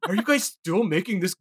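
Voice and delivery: whiny indignant nerd; hammed-up outraged fanboy rant; angry nerd voice. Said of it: "my angry nerd voice".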